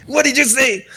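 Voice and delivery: very high, indignant halfling voice; hot-headed halfling. Acted. Halfling looks back at the captain who insulted him, hot-headed. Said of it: "hot-headed".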